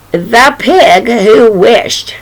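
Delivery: drawn out, slow and grand; slow title announcement. Shown as a demonstration. Storyteller slowly announcing the title to children. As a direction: announce the title slowly, savoring each word.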